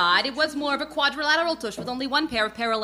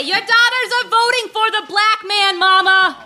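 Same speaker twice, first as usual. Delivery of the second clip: louder and higher, shouting to deaf mama; mock shouted announcement; 7 semitones higher, 7 dB louder than usual. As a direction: belt it across the room. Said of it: "And I say".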